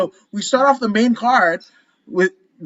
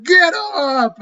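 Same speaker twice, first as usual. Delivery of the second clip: imitated yell; 7 semitones higher than usual. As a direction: yell it like a heckling fan, held back in volume. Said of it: "you started yelling".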